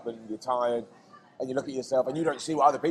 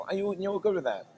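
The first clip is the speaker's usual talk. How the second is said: usual speaking voice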